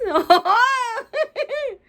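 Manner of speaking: a sudden high, loud laugh; burst of high laughter. Real, not acted. Reader laughing at the fountain plan, high burst of laughter.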